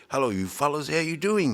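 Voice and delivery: high-pitched mock Māori-accented greeting; hammed-up Māori English accent; put-on Māori accent. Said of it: "I don't say".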